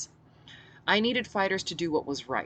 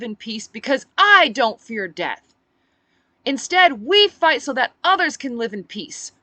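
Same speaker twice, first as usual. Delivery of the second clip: rousing speech; 11 semitones higher, 12 dB louder than usual. Acted. Speech to crowd.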